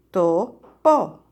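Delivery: "Topo" split into to, po with a gap; syllable by syllable. Shown as a demonstration. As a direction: separate both syllables of "Topo". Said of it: "¿Cuántas sílabas en topo?".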